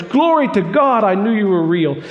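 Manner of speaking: high, loud cry of praise; joyful shout. Acted. Ed at his fire, shouting praise to God. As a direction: shout it out in triumph. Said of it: "and he shouts".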